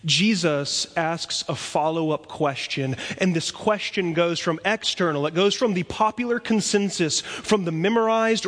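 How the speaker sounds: normal talking voice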